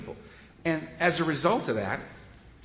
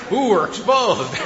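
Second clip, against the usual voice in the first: slow, higher mock panic; mock alarm; 5 semitones higher, 7 dB louder, slower than usual. Addicts caught by their dead lawn, in slow mock panic. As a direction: play caught-out dread. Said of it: "it's like".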